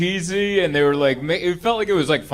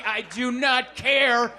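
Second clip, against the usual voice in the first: exasperated outburst; 5 semitones higher, much slower than usual. Real, not acted. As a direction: shut the story down hard. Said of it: "exasperated outburst".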